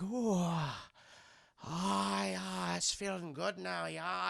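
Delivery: softly moaned, imitated pleasure; drawn-out oohs, sinking soft; acting weird. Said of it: "starts acting weird".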